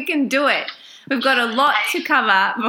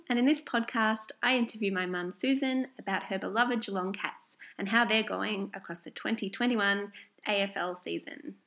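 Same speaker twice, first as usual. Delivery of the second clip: usual speaking voice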